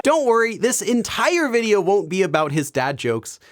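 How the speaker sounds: normal talking voice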